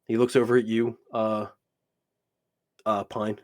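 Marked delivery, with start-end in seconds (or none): none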